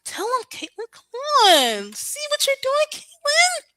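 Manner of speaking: put-on high, childlike pleading voice; weird little voice. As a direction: whine it like a regressed child. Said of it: "she does that little weird voice".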